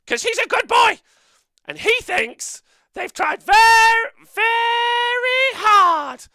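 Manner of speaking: very high squeaky puppet voice; Shilly voice. Acted. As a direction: shout it like a shrill little puppet. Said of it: "Shilly should swear less".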